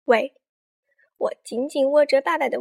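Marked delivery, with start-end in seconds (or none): 0.1-0.4 short loud challenging yell; loud shout. A narrator's reading. Bossy classmate confronting Ameng, loud shout. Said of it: "大声喊".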